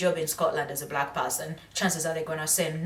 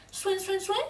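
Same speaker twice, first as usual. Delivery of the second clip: confused mock babble; 4 dB quieter than usual. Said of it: "And I'm like".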